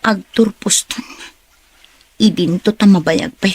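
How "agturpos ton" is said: a private whisper to herself; hushed inner monologue; whispered thought. Acted. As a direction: breathe it out unheard, as inner thought. Inner thought, unvoiced line.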